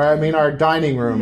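normal talking voice

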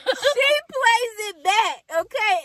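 high, loud laughing; laughing hard. Real, not acted. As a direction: crack up while telling it. Can't stop laughing.

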